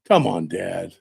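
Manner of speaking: usual speaking voice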